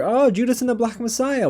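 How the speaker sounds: light up as you spot the name; delighted surprise. Real, not acted. Surprised by a nomination.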